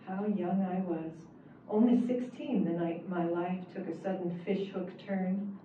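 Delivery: ordinary delivery, nothing marked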